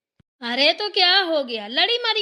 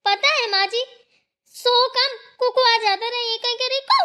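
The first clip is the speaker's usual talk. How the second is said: normal talking voice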